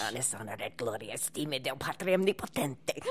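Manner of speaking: put-on old priest intoning an exorcism; old Italian priest. Guest performing audiobook exorcism for the hosts, old Italian priest voice. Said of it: "this old Italian priest who's doing an exorcism".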